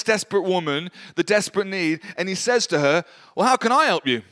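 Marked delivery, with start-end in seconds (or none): 3.3-4.2 a higher, louder, almost offhand offer of help; blunt matter-of-fact question. Retold or imitated. Prophet to desperate widow, matter-of-fact and unexpectedly blunt. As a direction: toss the question out casually, not compassionately. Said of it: "It's really funny".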